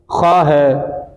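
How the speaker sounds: खा said very slowly and thickly from the throat; heavy letter demo. Shown as a demonstration. Teacher showing the student a heavy letter. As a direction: give खा a full, heavy sound, drawn out. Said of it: "तो बड़ा काफ है".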